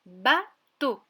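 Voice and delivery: "bateau" slow and loud, split ba, then to; syllable by syllable. Shown as a demonstration. Teacher presents the next word, syllable by syllable. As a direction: break "bateau" into two separate beats. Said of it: "J'aimerais que tu retrouves la syllabe".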